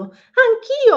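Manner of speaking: high, loud excited "Anch'io!"; bouncy triumphant squeal; eager cheer. Acted. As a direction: pipe up like a proud little elf. Elf joins in cheering.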